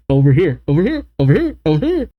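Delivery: high, quick teasing calls; playful taunt. Acted. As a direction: call them over in a high, playful voice. Voicing a keep-away taunt.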